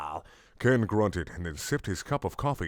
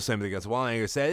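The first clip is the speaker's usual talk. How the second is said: ordinary talk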